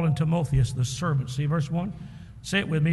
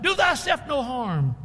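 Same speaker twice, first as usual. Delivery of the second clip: Paul shouts; 8 semitones higher than usual. Acted. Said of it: "But Paul shouted out".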